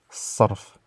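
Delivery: "Sarf" with a heavy emphatic s and a back a. Shown as a demonstration. Teacher demonstrating the darija word, emphatic s. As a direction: say "Sarf" with a dark, pressed s. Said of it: "c'est un S emphatique".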